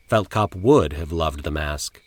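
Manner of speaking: ordinary delivery, nothing marked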